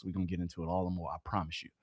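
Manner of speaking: very quiet, fast, low-pitched repeat; hushed, hurried promise on the side; low quick aside. Real, not acted. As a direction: drop right down and rattle it off under the voice.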